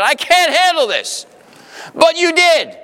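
high, lifted cry of doubt; high exclaim. Retold or imitated. Preacher voicing a listener's fear in a high cry. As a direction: throw the words up high in mock despair.

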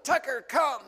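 loud, high yell to the dog; calling the dog. Retold or imitated. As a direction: call him back across the street. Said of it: "I yelled".

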